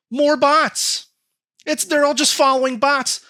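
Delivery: high, loud incredulous outburst; worked-up exasperation. Real, not acted. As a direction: burst out with the big reveal. Exasperated reveal of his research findings.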